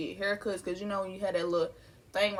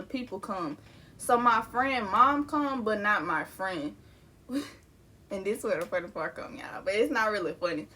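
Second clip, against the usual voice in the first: normal talking voice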